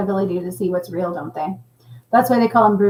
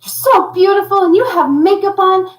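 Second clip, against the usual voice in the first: put-on high, eager kid voice; lightly voiced little boy complimenting; 10 semitones higher, 5 dB louder than usual. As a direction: say it high and earnest like a five-year-old. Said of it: "He's like".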